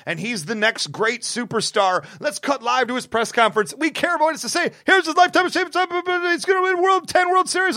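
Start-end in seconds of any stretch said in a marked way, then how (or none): 2.2-7.8 mock sportscaster hype. Mocking ESPN hype.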